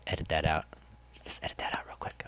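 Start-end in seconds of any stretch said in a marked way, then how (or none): 1.2-2.2 soft unvoiced whisper; hushed aside to the editor; whispered note to cut the clip. Real, not acted.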